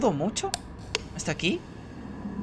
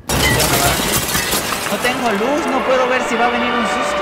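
nervous; 7 semitones higher, 5 dB louder than usual. Real, not acted. No light, fearing a scare.

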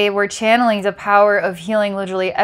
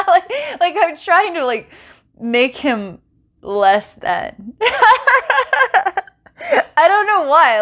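real giggly laughter, voice pitched up; laughing; 9 semitones higher, slower than usual. Real, not acted. Admitting a need to challenge his authority, laughing helplessly. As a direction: laugh through the words, breaking into high giggles. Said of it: "I'm laughing 'cause I need a breather".

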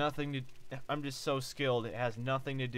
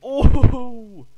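huge high shout of triumph; loud excited yell; 10 semitones higher, 20 dB louder than usual. Real, not acted. Gamer yelling as his defender blocks, loud triumphant shout. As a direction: scream it like you just stopped the dunk.

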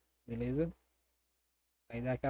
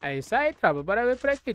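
excited; 8 semitones higher, 6 dB louder than usual. Real, not acted. Hype return to casting.

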